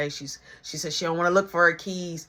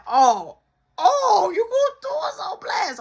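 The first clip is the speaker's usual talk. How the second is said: much higher, near-crying protest; panicked whine; 11 semitones higher, 5 dB louder than usual. Retold or imitated. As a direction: plead in panic as you get exposed. Kid panicking about being exposed.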